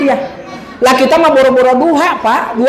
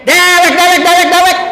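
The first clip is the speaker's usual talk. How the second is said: quacking duck; 6 semitones higher than usual. Acted. The duck answers it is only itself, a high quacking duck.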